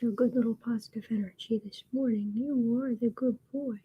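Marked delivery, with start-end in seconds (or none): 2.3-3.8 soft cooing pet talk; soft tender pet voice. Real, not acted. Cooing to the cat.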